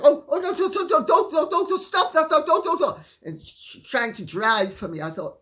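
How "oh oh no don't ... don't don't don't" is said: very high, loud mock panic; rapid-fire squawked protests, imitating a back-seat driver; frantic interjecting. Passenger trying to drive for the driver, shrieky mock alarm. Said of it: "And then she'd interject".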